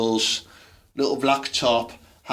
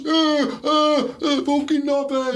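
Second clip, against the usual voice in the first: mock drunk lad; 14 semitones higher than usual. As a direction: ham up a lad shouting for shots. Mocking lads on Christmas night.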